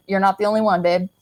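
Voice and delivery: ordinary delivery, nothing marked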